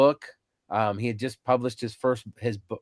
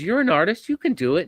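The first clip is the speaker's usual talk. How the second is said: confident; 7 semitones higher, 3 dB louder than usual. Retold or imitated.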